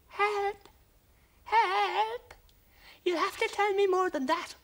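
very high, tiny frightened alien voice; trembling little voice. Acted. Little creature calling to the space hero for help, very high and trembling. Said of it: "a trembling little voice came back".

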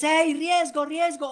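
high, urgent call; rousing shout. Shown as a demonstration. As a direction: fire them up from the line. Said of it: "usted los quiere activar".

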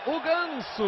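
ordinary talk

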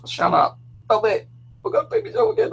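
high, squeaky imitation of a laughing mum; mum laughing helplessly. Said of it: "And she's laughing".

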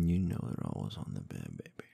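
plain reading voice

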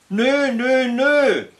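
high, loud teasing refusal; calling out. A narrator's reading. Elk refuses to help the reindeer, loud and high. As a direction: shout the no like a cheeky child. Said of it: "rief ich".

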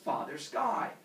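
plain reading voice

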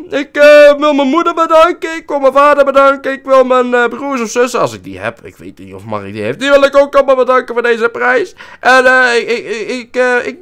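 high, loud, hammed-up award speech thanking everyone; mock acceptance speech. Gamer thanks his parents for the award, in a hammed-up acceptance voice. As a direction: gush your thanks like an overacted winner on stage.

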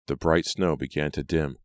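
ordinary delivery

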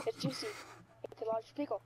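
ordinary delivery

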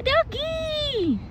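high, called-out Dougie; shouting. Acted. Tag calling out to Dougie, high shout. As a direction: call across the room for Dougie. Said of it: "shouts Tag".

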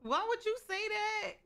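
high, stressed "would"; appalled high "would"; shocked. Real, not acted. Reacting to game event.

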